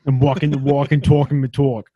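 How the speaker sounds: thick Long Island accent, hammed up. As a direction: put on a New York Long Island voice. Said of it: "Now say it in the Long Island accent".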